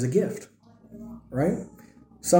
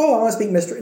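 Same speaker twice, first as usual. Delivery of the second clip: higher-pitched mocking wannabe; mock eagerness; 6 semitones higher, much faster than usual. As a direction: mimic a naive, eager believer. Mocks a would-be tongues speaker.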